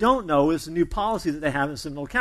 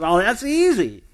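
smug drawl; 4 semitones higher, 5 dB louder, slower than usual. Retold or imitated. Applicant flips his hair, smug.

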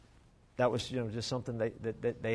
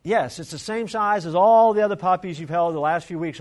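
weary mom; 4 semitones higher, 7 dB louder than usual. Retold or imitated. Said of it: "her mom said".

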